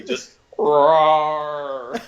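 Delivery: a loud spoken roar; mock roar. Cohost imitating Godzilla roaring at the camera, a mock roar. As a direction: bellow the word like a monster. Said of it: "like, roars".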